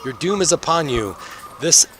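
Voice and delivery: high-pitched doomsayer pronouncement; prophesy doom to the crowd; ranting. Acted. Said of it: "continues on with his rant".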